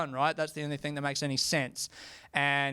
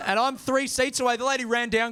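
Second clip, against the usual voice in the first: ordinary talk, nothing marked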